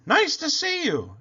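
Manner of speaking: very high hooted greeting; eager duckbill greeting; hooting. A narrator's reading. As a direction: hoot a welcome way up high. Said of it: "and they hooted".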